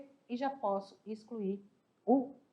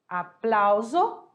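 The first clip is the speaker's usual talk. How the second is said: Aplauso said slowly again, the plau syllable pushed, rising at the end; stress on PLÁ; 4 dB louder, much slower than usual. Shown as a demonstration. Teacher repeats Aplauso slowly for the class, leaning on plau. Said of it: "No plá".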